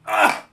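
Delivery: loud "ah" after a shot; loud gasp. Real, not acted. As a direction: let out a burning gasp. Reacting to downing a gin shot.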